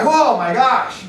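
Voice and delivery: stunned laugh. Retold or imitated. Stunned after hang-up.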